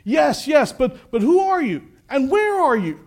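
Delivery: urgent calling up. Acted. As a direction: shout questions up to an unseen rescuer. Jack questions the unseen voice.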